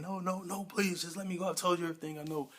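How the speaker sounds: acted-out plea for mercy; imitated begging. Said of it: "Jesse's begging".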